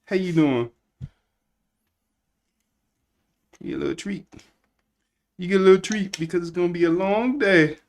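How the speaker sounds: high, sweet voice for the pet; pet talk. Real, not acted. Greeting and treating the pet.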